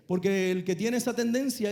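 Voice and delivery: usual speaking voice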